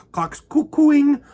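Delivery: cuckoo pitch. Real, not acted. Mimicking cuckoo in list.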